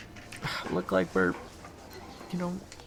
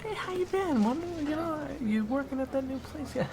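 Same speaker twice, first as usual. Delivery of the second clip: much higher, breezy put-on friendliness; fake cheerful small talk; 13 semitones higher than usual. Waiter Zach putting on breezy chat as cover. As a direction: sound casual for anyone watching.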